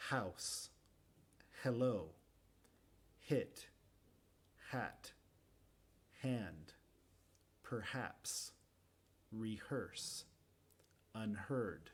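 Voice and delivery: "House", "hello", "hit" each with a clear breathy h; careful h practice list; aspirated h drill. Shown as a demonstration. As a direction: model each h word. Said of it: "Practica un poco conmigo".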